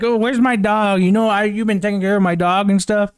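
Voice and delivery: high, rushed mock-drunk babble; drunk Supergirl. Drunk Supergirl asking Superman after her dog, mock drunk. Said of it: "like, drunk. She's like".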